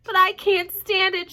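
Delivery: high, loud, slow shout. A narrator's reading. Wilbur shouting at Charlotte, high and loud. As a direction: shout it out in despair. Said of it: "shouted Wilbur".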